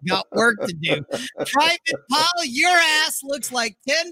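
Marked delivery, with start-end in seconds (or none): 1.5-4.1 drill sergeant. Retold or imitated. Quoting Full Metal Jacket drill instructor.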